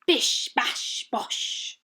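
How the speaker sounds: breathy bish bash bosh; whispered sound effects. A narrator's reading. Narrator whispering ice cubes hitting walruses. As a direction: hiss the impact noises.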